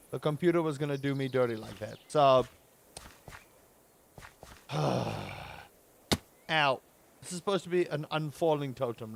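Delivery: ordinary talk, nothing marked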